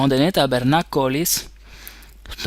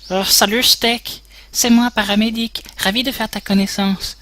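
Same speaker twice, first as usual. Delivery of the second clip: high put-on voice; 8 semitones higher than usual. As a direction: pitch right up for the radio medic. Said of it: "quel accent je pourrais".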